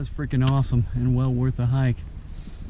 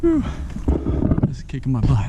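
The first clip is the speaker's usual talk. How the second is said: winded from the uphill climb; panting between words; out of breath. Real, not acted. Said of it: "I'm huffing and puffing".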